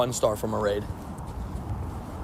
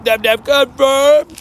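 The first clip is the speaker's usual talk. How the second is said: excited drawn-out call; 9 semitones higher, 4 dB louder, much slower than usual. Real, not acted. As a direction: stretch it out slowly, higher and louder. Cheering a new in-game bestie.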